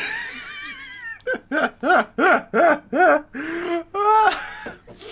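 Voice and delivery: high choking laugh after a sour shot; laughing cough. Real, not acted. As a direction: laugh through the burn.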